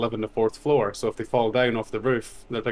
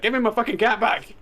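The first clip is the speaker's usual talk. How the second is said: higher, hammed-up outrage; mock furious demand; 11 semitones higher than usual. To the neighbor, a hammed-up angry demand. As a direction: pretend to explode at the nice neighbor. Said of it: "didn't wanna be like".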